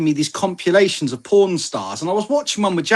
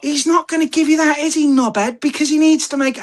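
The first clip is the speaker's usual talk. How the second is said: high-pitched exasperated mock shout; shouting at screen; 11 semitones higher than usual. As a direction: yell at the TV in a raised, incredulous pitch. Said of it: "I'm shouting at the screen going".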